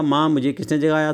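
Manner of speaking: ordinary talk, nothing marked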